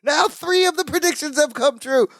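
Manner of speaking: high-pitched retold alarm; hammed-up frantic accusation; mock frantic outburst. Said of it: "grabs him and shakes him and goes".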